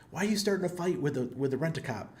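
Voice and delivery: high, fast mild scolding; wife scolding. Retold or imitated. Wife asking husband why he fights, high and quick. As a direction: rush the worried question like the wife. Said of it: "Anna's like".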